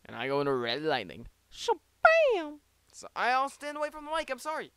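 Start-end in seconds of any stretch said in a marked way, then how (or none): 1.5-2.6 very high, loud squealed flourish; high triumphant cry. Pretend. Gamer sending out his Pokemon, high triumphant squeal. 2.9-4.7 very high, quick falsetto apology; squeaky apology. Pretend. Gamer apologizing for shouting into mic, squeaky falsetto.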